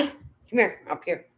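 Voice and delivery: high coaxing call to a pet; high singsong come here for a cat. Real, not acted. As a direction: coax the cat up to the camera. Calling the cat up.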